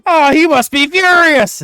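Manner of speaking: loud, roaring laugh, words shouted through it; bellowing laughter. Acted. Dwarf roaring with laughter at the dance offer. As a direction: howl with laughter at the joke. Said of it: "deep, bellowing laughter".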